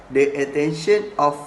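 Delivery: ordinary talk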